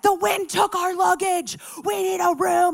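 loud, high frantic shout; going off. Retold or imitated. Guest going off on front desk gal, frantic. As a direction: imitate a woman yelling in panic. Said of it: "going off on this front desk gal".

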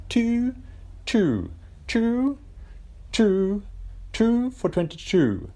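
put-on high sing-song Richie Benaud accent, stretching 'two'; dodgy Richie Benaud accent. Podcaster mimics the Australian cricket commentator, Richie Benaud accent. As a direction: imitate Richie Benaud drawing out each 'two'. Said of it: "that's my, uh, dodgy Richie, Richie Benaud accent".

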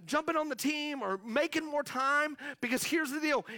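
ordinary delivery

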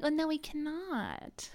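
high, slow breathy whisper of mock horror; mock timid whisper. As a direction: whisper it like a prissy hand-wringer. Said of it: "it's been like".

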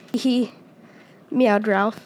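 ordinary delivery, nothing marked

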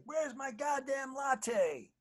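high, slow, shouted demand for a latte; hammed-up star yelling for his coffee; mock diva shout. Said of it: "he was like".

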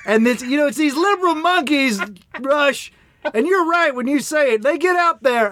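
hammed-up Southern radio caller; Southern accent. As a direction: play a Southern talk-radio fan gushing at Rush. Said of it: "why we're doing a Southern accent".

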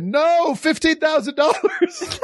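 high mocking squeak; squeaky quote of Carla; quoting Carla. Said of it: "And Carla said".

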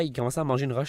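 ordinary delivery

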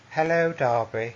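slow, put-on villain voice; teasing imitation of a soft-spoken psychopath; Simcoe. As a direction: draw out a creepy greeting. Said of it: "to go full Simcoe on her".

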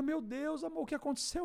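high, anxious question; retold worried wife. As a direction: imitate an alarmed wife. (esposa aflita, voz aguda)

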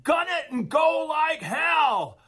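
high, drawn-out shout; screamed command. Retold or imitated. Josh screaming at the driver's window as the truck drifts back, urgent scream. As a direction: scream it urgently, stretching every word. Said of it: "runs to his window and screams".

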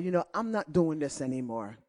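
low, quick and hushed husband fed up. Retold or imitated. Husband telling wife he's done killing bugs. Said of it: "my husband looked at me and said".

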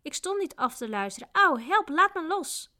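pained protest rising into high yelps; high, whining cries of a grabbed servant; wailing. A narrator's reading. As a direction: wail and beg to be released. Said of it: "jammerde de knecht".

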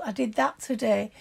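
ordinary delivery

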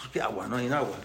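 low, soft, resigned reply; dejected father. Acted. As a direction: drop the voice, nothing to offer. Padre sin agua responde al hijo. (padre resignado, voz baja)

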